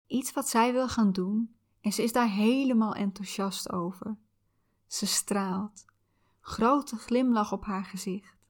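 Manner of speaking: calm soothing visualization guide. Acted.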